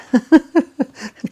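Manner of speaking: bright burst of laughter; high laugh. Real, not acted. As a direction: laugh out at the silly wish. Laughs at wanting to walk soil.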